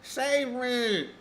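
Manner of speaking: high, soft, whimpering plea for rescue; whiny plea. Real, not acted. Starving streamer pleads to chat, high and whiny.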